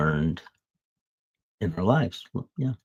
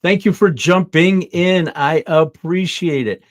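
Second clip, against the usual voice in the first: excited thanks; 10 semitones higher, 10 dB louder than usual. Real, not acted. Greeting viewers who joined live.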